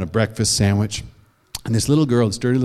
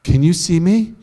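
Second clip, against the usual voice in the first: ordinary delivery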